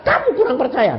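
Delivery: high-pitched, acted scolding; rebuke. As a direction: tell them off as a teacher would.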